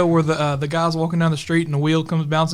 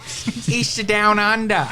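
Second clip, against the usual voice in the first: higher, slow drawn-out Aussie imitation; teasing Australian accent, slow; mock Australian accent; 5 semitones higher, 3 dB quieter, much slower than usual. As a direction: stretch it out like a bad Australian. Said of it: "That was terrible".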